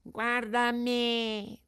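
the cat copying the fox in a high, whiny voice. Acted. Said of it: "disse il gatto".

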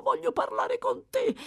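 very high pleading repeat; shrill plea. Acted. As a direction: beg the boy urgently. (supplica acutissima)

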